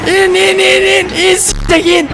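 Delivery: high, loud yell down at the screen; panicked shout. Real, not acted. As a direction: shout them downstairs in a panic. Panicked gamer yelling at screen. (panikle bağırarak)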